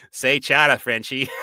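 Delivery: loud Boston accent, shouted. Shown as a demonstration. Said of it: "Is that close enough?".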